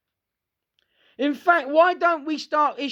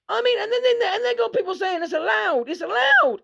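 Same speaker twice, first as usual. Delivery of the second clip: exasperated shout; 10 semitones higher than usual. Real, not acted. Ranting to viewers about what people allow. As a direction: yell it in disbelief.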